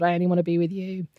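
ordinary delivery

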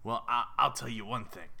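big New York goldfish with a rasp; intimidating raspy New Yorker. Acted. Fish boasting to its owner, intimidating New York rasp. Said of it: "sir, that sounds oddly intimidating".

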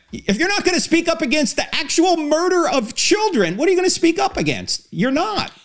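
indignant appeal. Real, not acted. Indignant plea about children.

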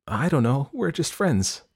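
put-on high, lame teenage answer; lame excuse. A narrator's reading. Daughter answering her mother's question, lamely. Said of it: "was her lame answer".

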